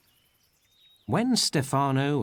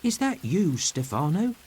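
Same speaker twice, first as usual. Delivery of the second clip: higher, hopeful ask; hopeful question; 6 semitones higher than usual. A narrator's reading. Trinculo under the raincoat hopefully asking for Stefano. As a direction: peek out and hope. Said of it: "he asks".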